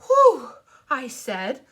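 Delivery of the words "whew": loud high puff of relief; breathless whew. Acted. Girl catching her breath, a loud high whew. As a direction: blow out a big high whew.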